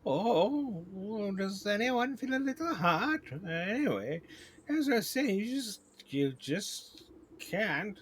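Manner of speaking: high queenly voice losing its thread; woozy queen bee. Acted. Queen feels a little hot mid-rant, distracted.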